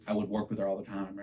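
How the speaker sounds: ordinary talk, nothing marked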